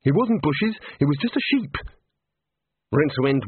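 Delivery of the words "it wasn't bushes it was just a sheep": high-pitched, defensive squawk; high indignant correction; blurted protest. A narrator's reading. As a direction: protest in a high, put-upon voice. Rincewind blurts protest to accusers.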